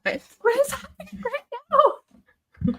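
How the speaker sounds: high, slow disbelieving cry; bewildered. Real, not acted. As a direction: stretch it out in disbelief. Mom bewildered.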